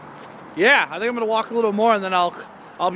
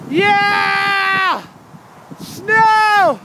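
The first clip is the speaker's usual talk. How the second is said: ecstatic screaming; 5 dB louder, much slower than usual. Acted. Screaming joyfully in snow.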